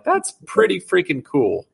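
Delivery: high, slow, drawn-out admiration; impressed. Retold or imitated. Host reacting to loyal fans' greetings, impressed.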